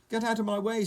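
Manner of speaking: high, loud order; cross storybook shout; rude snap. A narrator's reading. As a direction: snap at someone in your path. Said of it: "snapped Mister Uppity rudely".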